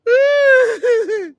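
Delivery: loud squealing laugh; high cackling laugh. Real, not acted. Laughing at her own joke, high loud cackle.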